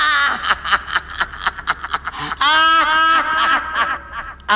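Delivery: villain laugh. Shown as a demonstration. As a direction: throw back a big wicked laugh. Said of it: "magkakaroon ng sarili mong tawa".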